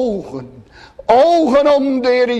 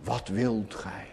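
much lower and softer, gentle asking; hushed tender question; 9 semitones lower, 13 dB quieter than usual. Retold or imitated. Preacher voicing the Lord's question to Bartimaeus, hushed and gentle. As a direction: ask it as if bending close to a blind man. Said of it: "dan vraagt hij".

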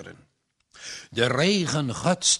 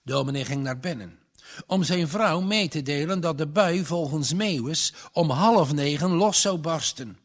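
ordinary delivery

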